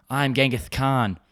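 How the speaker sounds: slow lisping tough-guy line; Genghis Khan with a lisp. Retold or imitated. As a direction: lisp the s sounds, slowly. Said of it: "What if Genghis Khan had a lisp?".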